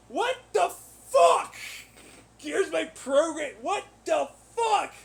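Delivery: high, loud, outraged cries; fed-up shout ending in a cry; exasperated shouting. Real, not acted. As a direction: yell it like you can't believe them. Ranting about rejected program.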